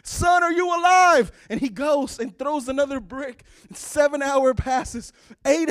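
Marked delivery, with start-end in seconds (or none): 0.0-1.3 loud, high, pleading shout; father calling for his buried son; desperate shouting. Acted. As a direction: yell it into the rubble. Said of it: "calling for his son".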